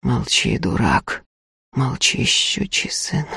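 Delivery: low, hushed muttering; very soft, low rebuke into an ear; barely audible hiss. A narrator's reading. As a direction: order him silent under your breath. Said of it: "чуть слышно цедил на ухо сыну".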